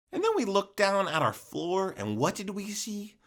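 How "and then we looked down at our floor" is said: usual speaking voice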